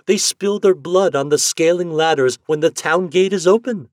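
high, loud mocking of men; scornful wife. A narrator's reading. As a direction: pity them with scorn. Wife mocking men's toil.

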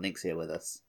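usual speaking voice

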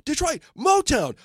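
high, loud, excited Liverpool voice; playful high-pitched shouted impression. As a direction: gush like an overjoyed star. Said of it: "And he's going".

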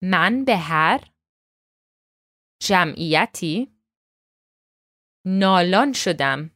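Man be har jam'iyati nalan shodam, slowly with pauses; slow recitation. Shown as a demonstration. Teacher leads the class through the line slowly. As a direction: say it slowly, phrase by phrase. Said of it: "let's try to say this again together".

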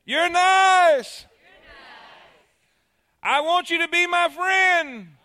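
high, loud, a bit slow; boy calling friendship into cave; joyful call. Acted. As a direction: call out in delight. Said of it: "what he heard".

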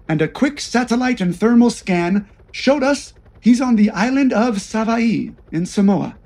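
plain reading voice

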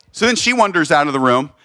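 ordinary talk, nothing marked

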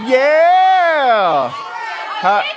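loud high whooping yeah; imitating an excited audience member; mock crowd cheer. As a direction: shout it like a fan in the back. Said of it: "Somebody back there was like".

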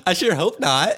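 laughing through the words; giggling reply. Real, not acted. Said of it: "laughing at vocal fry joke".

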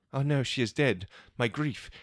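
ordinary talk, nothing marked

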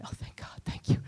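hushed private thanks; whispered relief. Retold or imitated. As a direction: whisper a grateful little prayer to yourself. Private thought about empty seat.

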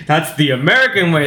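lightly pompous patriotic eagle; proud, stiff declaration; Sam Eagle voice. Retold or imitated. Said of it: "Sam Eagle".

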